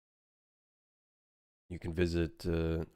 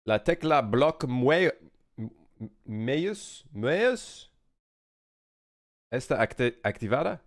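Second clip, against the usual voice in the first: halting, searching Spanish, rising at the end; clumsy attempt at Spanish; fumbling Spanish attempt; 4 semitones higher, slower than usual. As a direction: stumble through Spanish words, unsure. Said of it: "let me say it in Spanish".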